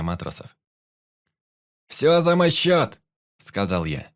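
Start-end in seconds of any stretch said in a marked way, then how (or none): 1.9-3.0 loud, high, drawn-out call; captain's festive shout; jubilant announcement. A narrator's reading. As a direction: proclaim it to the whole crew. Captain announces treat to crew.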